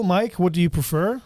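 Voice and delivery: usual speaking voice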